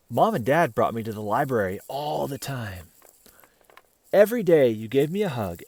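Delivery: ordinary delivery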